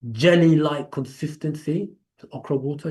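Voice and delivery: ordinary talk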